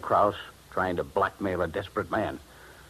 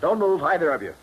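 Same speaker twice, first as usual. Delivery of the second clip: sharp threat, higher and louder; menacing order; 9 semitones higher, 4 dB louder than usual. Acted. Wentworth holding a gun on Dollar and Kraus, menacing loud order. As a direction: freeze them at gunpoint. Gunman surprises the two men.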